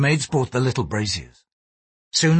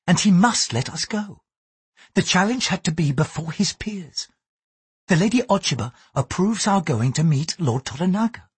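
Mariko, gentle Japanese lady; 7 semitones higher than usual. A narrator's reading. Mariko warns her lover why he is guarded, higher, earnest. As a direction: speak up in a high, earnest woman's register.

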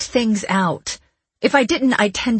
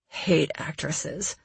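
low, soft and slow grumble; grumbling; 4 semitones lower, 8 dB quieter, slower than usual. A narrator's reading. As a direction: grumble it under your breath. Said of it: "he grumbled".

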